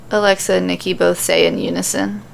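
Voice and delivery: usual speaking voice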